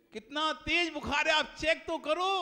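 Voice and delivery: high, loud worried plea; mother pleading with the doctor, high and loud; anxious pleading. Acted. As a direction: urge him to check, almost shrieking. Mother begs doctor check sickle.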